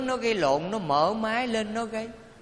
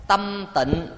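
slow, measured phrases with long pauses; deliberate call-and-repeat recitation; leading group recitation; 3 semitones lower, much slower than usual. Real, not acted. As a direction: lead the congregation phrase by phrase. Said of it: "Mời quý vị cùng nói với thầy".

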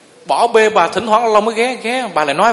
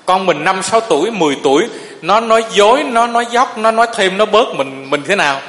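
louder, emphatic sermon delivery; preaching with force; 5 dB louder than usual. Acted. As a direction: drive the point home to the hall. Monk preaches to the congregation.

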